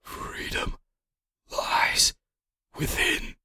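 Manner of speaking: slow whisper, word by word; hushed whisper. A narrator's reading. Dying father imparts last words to his son, whispered. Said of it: "with a hushed whisper said".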